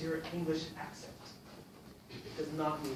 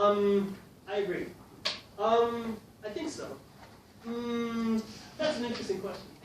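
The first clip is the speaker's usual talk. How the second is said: long, higher ums before each answer; filler habit; 5 semitones higher than usual. Shown as a demonstration. Teacher mimics students stalling before answers, hesitant. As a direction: stall with a hum, then answer. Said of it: "it's often a bad habit".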